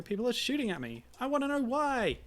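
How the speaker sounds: high, loud, building demand; exasperated outburst. Acted. Player voicing a hero demanding answers after being shot at, exasperated outburst. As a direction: build to a loud cry of frustration.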